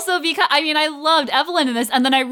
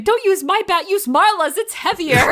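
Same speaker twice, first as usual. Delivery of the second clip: high-pitched movie line imitation; quick-witted call in a raised, higher voice; Doris; 8 semitones higher than usual. Quoting a dubbed ballplayer swapping bats, high mock call. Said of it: "Doris saying".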